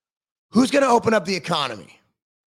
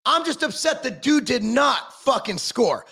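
usual speaking voice

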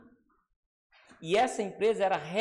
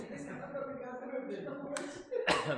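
hard cough from choking; 9 semitones higher, 6 dB quieter than usual. Real, not acted. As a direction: cough out a choke.

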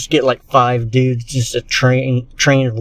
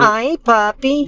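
slow high-pitched; 9 semitones higher, much slower than usual. Acted. Acted awkward greeting, slow.

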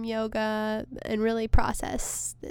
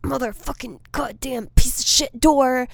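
retold cursing; 4 semitones higher, 4 dB louder than usual. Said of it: "start being like".

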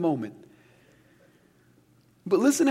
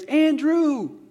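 higher, louder call up the stairs; imitating a parent calling; 9 semitones higher, 5 dB louder than usual. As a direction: call the boy's name from upstairs. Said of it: "my parents would yell from the upstairs".

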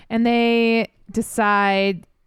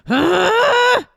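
much higher, louder huh; mock indignant huh; 14 semitones higher, 6 dB louder than usual. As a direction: yelp it like a peeved man. Angry man on phone.

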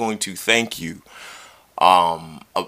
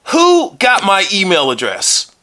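high, slow mock indignation; embellished annoyance for the show; upset, hammed-up; 9 semitones higher, 4 dB louder, slower than usual. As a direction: demand it in exaggerated outrage. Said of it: "you sound very upset".

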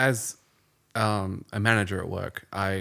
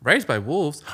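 ordinary talk

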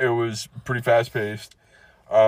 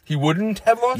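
high, rising disbelief; mock-astonished echo; incredulous; 9 semitones higher than usual. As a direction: question it high, as if astounded. Acted disbelief at partner's joke.

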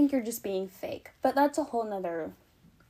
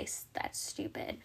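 whispered; 9 dB quieter than usual. Retold or imitated. Quoting herself, dropped voice.

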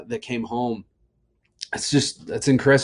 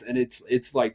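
normal talking voice

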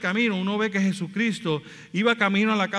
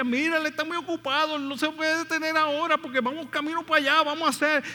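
high, brushing-off busy voice; dismissive crowd; 8 semitones higher than usual. Retold or imitated. Crowd telling the blind man Jesus is busy, dismissive. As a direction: wave the man away, too busy. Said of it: "todo el mundo decía".

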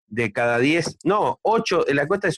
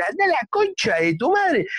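furious customer; 13 semitones higher than usual. Acted. Joke: furious customer insults waiter.

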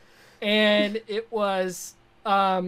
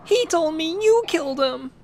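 Luke Skywalker voice; 8 semitones higher than usual. Pretend. Luke Star Wars parody.

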